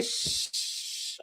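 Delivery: whispered hush; shushing. Retold or imitated. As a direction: shush the dreamer. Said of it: "they say".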